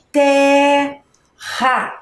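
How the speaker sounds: "Terra" with a long held TER, then RA; syllable by syllable. Shown as a demonstration. Teacher reading back what was written, "Terra" split up. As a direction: hold the first syllable of "Terra", then place the second. Joining syllables after spelling.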